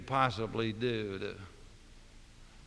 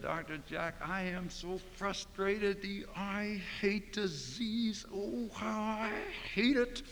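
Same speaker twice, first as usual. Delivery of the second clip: frustrated sob; 8 semitones higher, 3 dB quieter, slower than usual. Retold or imitated. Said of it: "he was sobbing".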